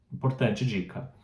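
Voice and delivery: ordinary delivery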